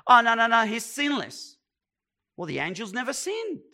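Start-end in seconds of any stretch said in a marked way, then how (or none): 0.1-1.6 louder, higher imagined protest; hammed-up hasty rebuttal; mock objection. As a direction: jump in loudly as an objector correcting the point. Voicing an objector's quick protest.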